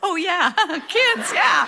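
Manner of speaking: high sheepish laugh through the words; yelling, laughing. Retold or imitated. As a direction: remember the kids and laugh it off. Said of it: "And my dad yells".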